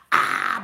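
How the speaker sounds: loud delighted "ah!" on a viewer arriving; open-throated happy shout; delighted squeal. Real, not acted. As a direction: burst out with a happy cry. Excited as viewers join chat.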